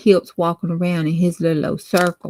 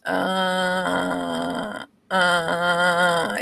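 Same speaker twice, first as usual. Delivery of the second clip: ghost moaning; 2 semitones lower, 6 dB quieter than usual. A narrator's reading. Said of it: "it got louder and louder".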